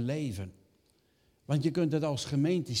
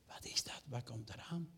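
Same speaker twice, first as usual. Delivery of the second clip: scared whisper; 13 dB quieter than usual. Acted. Fishermen see a figure at night.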